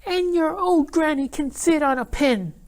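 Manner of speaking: taunting little pig, very high; pig yelling insult. Acted. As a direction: jeer at the wolf's granny, high-pitched. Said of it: "the third little pig yelled".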